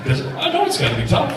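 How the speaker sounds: high, laughing mock pity; mock sympathy. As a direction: tease them with fake sympathy, chuckling. Teasing rival fans in Lexington.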